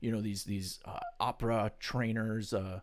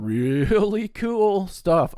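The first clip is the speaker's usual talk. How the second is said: loud, swooping high "really"; showy chest voice; 10 semitones higher, 11 dB louder, much slower than usual. Shown as a demonstration. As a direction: show off the voice with a big soaring flourish. Showing off rich chest voice.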